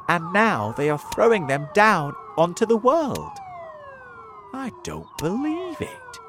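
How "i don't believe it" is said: high-pitched mock shout, no real volume; exclaimed disbelief. A narrator's reading. Centipede reacts to the hailstones, high disbelieving cry. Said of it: "shouted the centipede".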